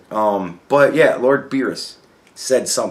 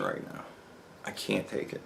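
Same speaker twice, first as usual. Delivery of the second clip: whispered frustration; 3 semitones lower, 16 dB quieter than usual. Real, not acted. Frustrated at break.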